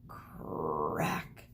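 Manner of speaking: low, drawn-out creaking crack sound; a low groaning crack noise; deep creaky crack. Acted. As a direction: voice the tree splitting, low and slow. Sound effect of cracking tree.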